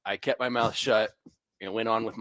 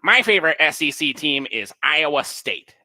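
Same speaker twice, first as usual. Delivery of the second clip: higher-pitched imitation of a clueless fan; mock nerd voice; 4 semitones higher, 4 dB louder than usual. Nerd butting in at the checkout, mock nerd voice. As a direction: put on a know-nothing nerd's voice, pitched up. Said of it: "in this exact voice".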